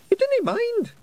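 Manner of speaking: very high hopeful pixie. A narrator's reading.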